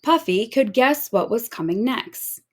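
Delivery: ordinary delivery, nothing marked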